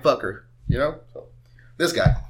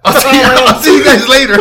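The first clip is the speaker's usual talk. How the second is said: higher and louder, laughing through it; laughing exit; 9 semitones higher, 10 dB louder than usual. Retold or imitated. Retelling how he left his coworkers, laughing. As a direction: bail out awkwardly while laughing. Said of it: "I was like".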